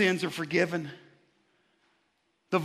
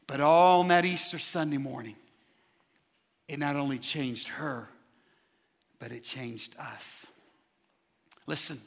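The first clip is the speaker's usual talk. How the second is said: lower, fading to very soft; hushed preaching; 5 semitones lower, 4 dB quieter than usual. Acted. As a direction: let the sermon sink almost to a hush. Preacher drops voice.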